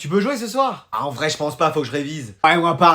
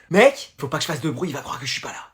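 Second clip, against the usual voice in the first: lower, much softer murmur; hushed; 6 semitones lower, 9 dB quieter than usual. Acted. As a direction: stay hidden, barely a breath. Hiding from his friend.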